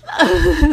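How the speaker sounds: a tearful half-laugh, half-cry; teary laugh. Real, not acted. Overcome while telling the story, a tearful laugh. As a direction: laugh through tears. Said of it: "Sorry, I'm getting emotional again".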